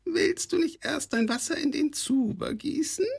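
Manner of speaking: very high, sly goblin question; a scheming goblin sweetly asking; cunning kobold voice. A narrator's reading. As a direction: coax him like a scheming goblin.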